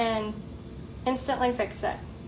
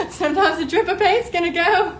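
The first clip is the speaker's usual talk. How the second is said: laughing through it; 5 semitones higher, 6 dB louder than usual. Real, not acted. Laughs at own paint drip.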